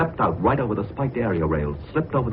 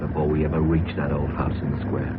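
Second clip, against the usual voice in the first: grim story narration; 8 semitones lower than usual. A narrator's reading. Narrator recounting the dying professor, low and ominous. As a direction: tell the grim ending gravely and deliberately.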